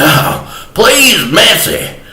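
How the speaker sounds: high, pleading whine; hammed-up high plea for mercy; mock begging. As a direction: beg for mercy in a comic high voice. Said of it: "I was begging her".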